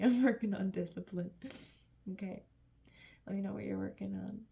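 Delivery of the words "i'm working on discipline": louder repeat through a laugh; amused, laughing self-tease. Real, not acted. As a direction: laugh it out at yourself. Laughing repeat of her own line.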